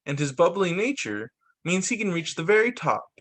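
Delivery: even, measured reading of a picture-book script; storybook narration. A narrator's reading. Narrator reading the dog ADHD story to viewers, storybook style. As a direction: read it like a gentle explainer book.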